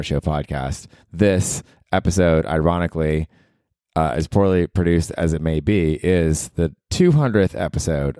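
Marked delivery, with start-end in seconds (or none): none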